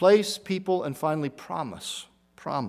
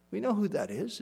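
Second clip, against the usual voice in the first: soft repeat; 4 semitones higher, 7 dB quieter than usual. Real, not acted. Preacher to congregation, repeating the line hushed. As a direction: drop the voice right down on the repeat.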